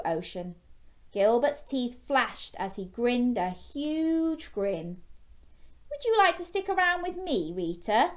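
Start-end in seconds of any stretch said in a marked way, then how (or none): 5.9-8.1 high, eager character voice; friendly young shark. Acted. Gilbert inviting a new friend, high hopeful voice. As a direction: start high, drop on the name.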